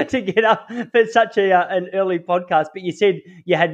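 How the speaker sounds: high-pitched, laughing through the words; amused, voice lifted by laughter; laughing while talking. Real, not acted. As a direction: talk through a real laugh. Host laughs about the early hour.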